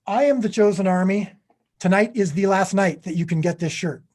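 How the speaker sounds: upbeat salesman pitch; chipper 1950s ad. Acted. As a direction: sell it like an old ad. Merch ad pitch.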